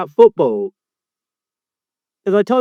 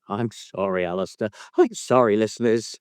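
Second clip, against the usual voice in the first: small, low, quiet mock whimper; 9 semitones lower, 6 dB quieter than usual. Host mimics his co-host apologising to listeners, mock whimpering. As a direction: whimper a pretend apology, much lower and softer.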